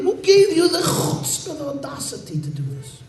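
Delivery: high, frantic scolding; rebuking. Acted. Community head rebuking Chaim Yankel, high frantic scolding. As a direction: berate him in panic. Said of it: "begins rebuking him and chastising him".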